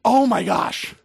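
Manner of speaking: loud, high burst of enthusiasm; gushing excitement. Retold or imitated. Preacher shows his friend bubbling over about God, loud and excited. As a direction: erupt like shaken soda. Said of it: "That's Cassie".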